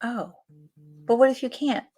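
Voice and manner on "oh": normal talking voice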